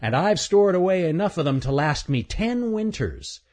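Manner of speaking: high, bragging chipmunk voice; boastful chipmunk. Acted. Chipmunk boasts of stolen nut store, high smug voice.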